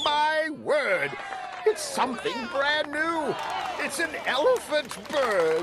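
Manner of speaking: high, thrilled exclaiming; amazed shout. A narrator's reading. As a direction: announce the wonder with delight. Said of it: "they shouted".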